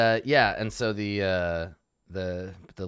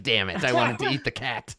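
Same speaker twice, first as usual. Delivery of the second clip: laughing grumble; 4 semitones higher, 6 dB louder than usual. Retold or imitated.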